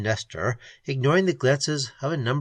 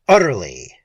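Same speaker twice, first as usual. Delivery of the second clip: louder curt snap; snapped; 3 semitones lower, 7 dB louder than usual. Acted. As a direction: bite off the word. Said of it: "snapped Keene".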